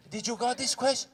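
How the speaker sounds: usual speaking voice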